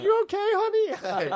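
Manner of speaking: very high, slow falsetto, mock-sweet; doting wife. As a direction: coo it like a fussing wife, hammed up and loud. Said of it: "like I'm his wife".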